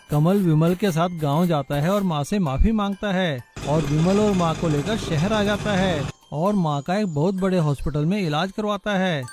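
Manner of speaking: ordinary delivery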